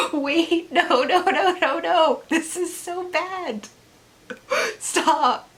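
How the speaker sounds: high, squeaky protest through laughter; comic high-pitched dismay; mock-horrified protest. Acted horrified reaction to plot.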